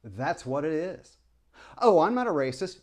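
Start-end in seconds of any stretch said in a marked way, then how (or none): 1.8-2.8 mock denial. Mocking quoted denial.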